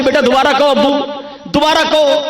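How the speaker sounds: put-on high, delighted coaxing of a baby; coaxing father. Father coaxing his baby to say abu again, delighted.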